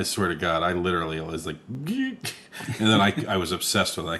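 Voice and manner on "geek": high squeak. Retold or imitated. Imitating the bear sound.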